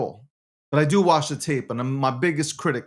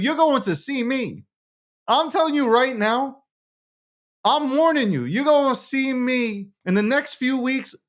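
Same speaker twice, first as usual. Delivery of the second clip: hyped warning; 7 semitones higher than usual. Real, not acted.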